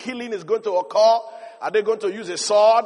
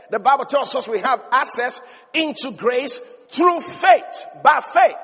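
preaching climax; 2 semitones higher than usual. Acted. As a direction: shout the word home.

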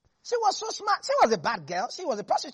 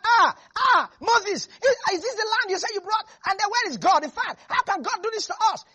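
much higher and louder, panicked protest; distressed wailing crowd; 9 semitones higher, 5 dB louder than usual. Acted. Preacher voicing the panicked Israelites complaining to Moses, loud distressed wailing. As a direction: cry out to Moses in despair.

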